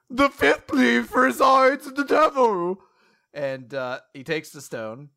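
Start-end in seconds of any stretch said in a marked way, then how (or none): 0.1-2.8 high, loud, theatrical villain; Ronnie's villain reveal. Retold or imitated. Host voicing Ronnie revealing who it was, hammy villain. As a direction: proclaim it with hammy menace. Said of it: "Ronnie's like".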